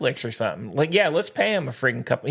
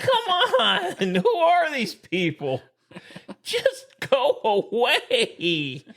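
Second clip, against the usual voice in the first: exasperated rant; 10 semitones higher, much slower than usual. Real, not acted. Ranting, laughing exasperation.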